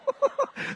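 quick high breathy laugh; brief airy chuckle. Real, not acted. Preacher laughs between remarks.

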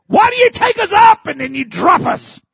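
very high, loud pleading; anguished cry to God. Retold or imitated. Preacher crying in the parking lot to God, anguished shout. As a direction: cry out why to heaven. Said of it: "just crying".

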